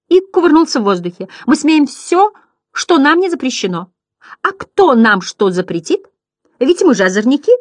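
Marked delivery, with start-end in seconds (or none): 1.5-7.5 high, boisterous clown voice; shouting. A narrator's reading. Second clown somersaulting in the air, high defiant cry. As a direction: declare it loudly, high and cheeky. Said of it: "закричал другой".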